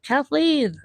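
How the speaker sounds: high-pitched imitated call; calling a name. Guy calls out her name.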